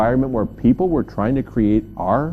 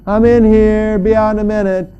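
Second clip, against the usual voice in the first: loud, high, sing-out call; raised voice, held high and stretched; calling through door; 7 semitones higher, 6 dB louder than usual. Acted. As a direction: holler it to someone rattling the door.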